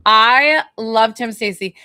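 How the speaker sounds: loud, drawn-out New York accent. Real, not acted. Medium gushing to client about her loved one, loud New York accent. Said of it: "Is that why my New York accent".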